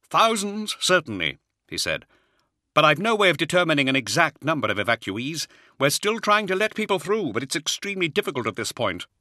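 a harried Jaeger officer reporting under pressure; Hadziel, harried officer. Acted. Officer's voice held by narrator.

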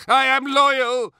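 high, loud protestation of loyalty; desperate begging. A narrator's reading. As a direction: plead for your life, insisting on loyalty. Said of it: "he begged".

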